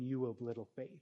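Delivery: low, quiet, gentle rebuke; soft rebuke. Retold or imitated. Jesus to his fearful disciples, quietly rebuking. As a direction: say it softly, almost under the breath. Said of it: "and he's saying".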